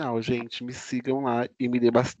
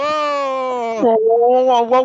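excited wow; 8 semitones higher, 6 dB louder, much slower than usual. Real, not acted. Hosts hyping the show opening.

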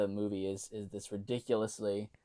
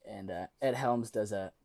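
ordinary talk

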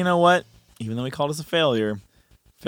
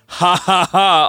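mock mad-scientist cackle, higher and louder; maniacal laugh; 6 semitones higher, 5 dB louder, slower than usual. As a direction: ham up the villain's triumphant laugh. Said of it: "all exclamation points, all capitalized".

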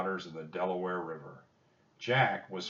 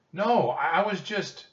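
much higher, stammered protest; flustered, lost for words; 10 semitones higher, 8 dB louder than usual. A narrator's reading. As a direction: protest in a high voice, then break off. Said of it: "Jack was at a loss for words".

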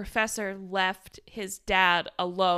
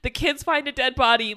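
normal talking voice

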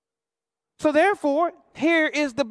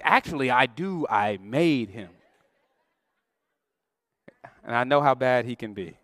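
God answering; 10 semitones lower than usual. Acted. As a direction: speak as God, patiently setting him straight. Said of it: "he's like".

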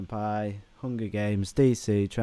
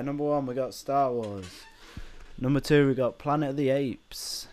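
bingo announcer; 2 semitones higher than usual. Pretend. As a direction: call them out like bingo night. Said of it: "Why do you sound like a bingo announcer?".

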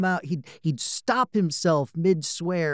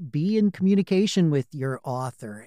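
ordinary delivery

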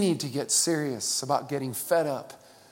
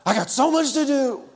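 higher mock frazzled complaint; busy person; 8 semitones higher, 4 dB louder than usual. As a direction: play the harried rusher.

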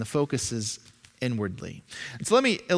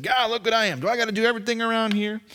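exasperated; 7 semitones higher, faster than usual. Retold or imitated. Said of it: "where I said".

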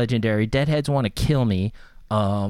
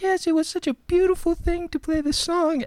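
high, gentle falsetto; dreamy little high voice. Retold or imitated. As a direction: imitate a sweet, mild-mannered guy. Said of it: "most soft-spoken, quiet guy ever".

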